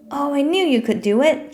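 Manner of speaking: relieved. A narrator's reading. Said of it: "chanted the squirrel in great relief".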